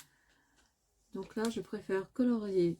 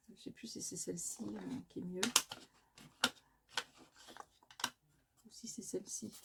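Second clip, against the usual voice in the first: whispering to herself; 10 dB quieter, faster than usual. Real, not acted. Hesitating between two inks.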